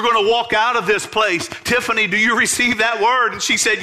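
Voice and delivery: fiery preaching. Acted. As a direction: preach it out with growing fire. Preacher builds to full force.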